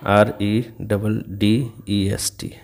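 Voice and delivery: आरईडबलडीईएसटी spelled very slowly, letter by letter; slow spelling. Shown as a demonstration. Teacher spelling reddest for the class, very slow. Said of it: "comparative".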